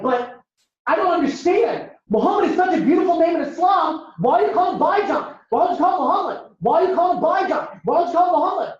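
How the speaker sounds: high-pitched, exasperated bewilderment. Acted. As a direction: plead for sense, pitched high and insistent. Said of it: "Palestinian kid's even more confused".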